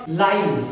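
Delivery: लाइव said with a long i, rhyming with five; adjective live. Shown as a demonstration. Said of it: "इसका pronunciation हो जाएगा".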